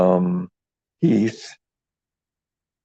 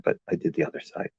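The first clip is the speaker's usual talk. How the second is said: secret aside; 4 semitones lower, 9 dB quieter, much faster than usual. Acted. Confiding a secret to viewers.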